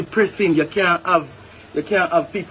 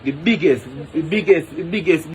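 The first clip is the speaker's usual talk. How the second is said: incredulous outburst; 4 semitones higher than usual. Real, not acted. Ranting to camera about hotel wages, incredulous and sputtering. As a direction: pitch jumps higher, stammering in disbelief.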